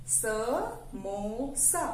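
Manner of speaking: समोसा very slow, as स, मो, सा; syllable by syllable. Shown as a demonstration. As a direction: stretch समोसा into three separate syllables. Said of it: "तीन अक्षरों से मिलकर बना है".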